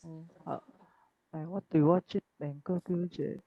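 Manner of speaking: ordinary delivery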